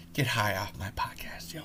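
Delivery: conspiratorial whispered title. Pretend. Host whispering his podcast's name to listeners. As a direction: breathe the podcast's name like a secret.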